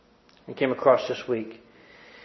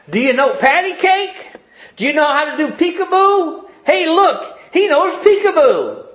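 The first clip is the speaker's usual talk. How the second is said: put-on very high, loud drunken calls; shouting across the room; 6 dB louder than usual. A narrator's reading. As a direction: yell it across the restaurant. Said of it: "began shouting from across the room".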